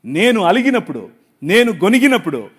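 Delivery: very loud, ringing proclamation; full-force preaching. Acted. Pastor proclaiming to the congregation that his king lives, full-force preaching.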